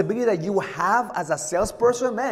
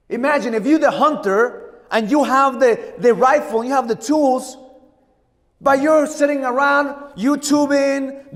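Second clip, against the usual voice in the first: higher and louder, driving each phrase home; fired-up rant; 8 semitones higher, 5 dB louder than usual. Real, not acted. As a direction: preach it at full force to wake the room. Rising rant at complacent salespeople.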